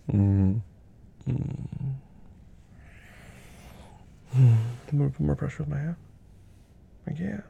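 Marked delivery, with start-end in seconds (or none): none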